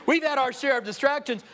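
ordinary delivery

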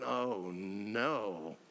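low, drawn-out dread; dismayed Saul. Acted. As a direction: sink low as if realizing you're in trouble. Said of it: "I'm thinking Saul's going".

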